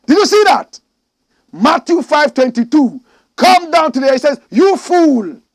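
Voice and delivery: shouted high and loud; preacher roaring at the congregation; fiery preaching. Acted. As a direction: hammer the verse home. Full-force preaching of the verse.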